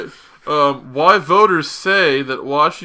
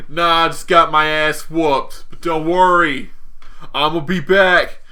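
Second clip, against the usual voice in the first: put-on terrible New England accent; 2 semitones higher, 3 dB louder than usual. As Spider-Man back home after a beating, bad New England accent. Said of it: "I'm doing terrible New England accent now".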